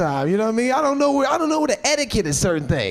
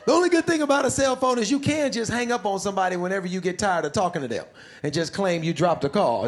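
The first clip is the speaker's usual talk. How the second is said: usual speaking voice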